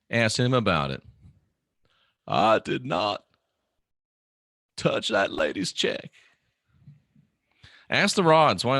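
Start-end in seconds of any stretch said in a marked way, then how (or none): 2.2-6.1 halting politician impression, voice changed from your own; put-on presidential voice, broken into deliberate phrases; Bill Clinton. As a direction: mimic a famous politician's denial, with long pauses. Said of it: "What would Bill Clinton say".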